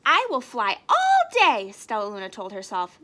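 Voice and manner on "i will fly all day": high and loud, resolved; determined young bat. Acted. Stellaluna promises herself, high and loud. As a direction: shout your resolve like a small bat.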